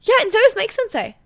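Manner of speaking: high, loud and delighted; beaming schoolgirl. Acted. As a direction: beam it out. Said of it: "Akizuki beamed".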